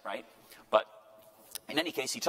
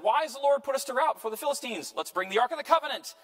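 high, loud acted protest and rallying call; elders' dismayed outcry; 10 semitones higher, 5 dB louder than usual. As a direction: cry out as baffled elders rallying the troops. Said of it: "The elders of Israel said".

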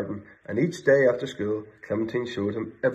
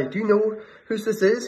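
urgent question; 11 semitones higher than usual. Acted. Bear asking fleeing woman.

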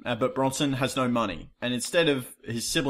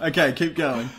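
ordinary talk

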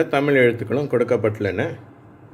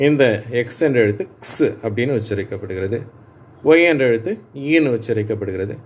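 ordinary delivery

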